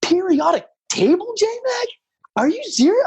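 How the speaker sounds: high, disbelieving mock outcry; hammed-up astonished colleagues; incredulous board members. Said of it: "they were like".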